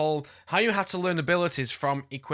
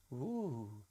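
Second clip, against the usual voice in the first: very soft, low, drawn-out cooing; distracted wonder; 5 semitones lower, 13 dB quieter, much slower than usual. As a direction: drift off, hushed, as if mesmerised. Mimes losing attention, joking about attention span.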